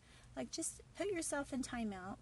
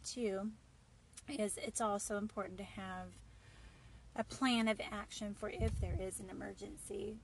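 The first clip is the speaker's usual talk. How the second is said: ordinary delivery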